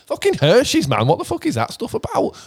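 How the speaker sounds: high incredulous rant; mock outrage. Real, not acted. Mocking American chocolate to Pete, high incredulous rant. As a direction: pitch it way up in disgust.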